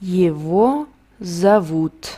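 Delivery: Его зовут said slowly, word by word; Его зовут, lento y claro; slow model phrase. Shown as a demonstration. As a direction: drill it slowly for the class. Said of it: "¿Cómo lo decimos en ruso para un chico?".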